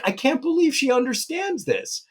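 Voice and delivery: high, delighted disbelief; mock-amazed high exclaim; amazed parent. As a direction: gush like a parent stunned by their kid. Parents marvelling at their child.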